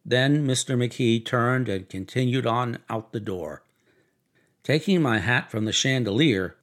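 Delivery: ordinary delivery, nothing marked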